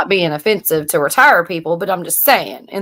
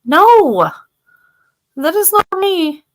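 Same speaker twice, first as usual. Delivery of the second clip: mock outrage, pitched well up; incredulous; 10 semitones higher, much slower than usual. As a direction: act shocked and refuse it. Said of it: "and I'm like".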